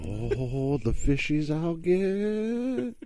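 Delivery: high, drawn-out daydreaming voice; swooning over the prize; dreamy fisherman. Acted. Acts Rube dreaming of fishing.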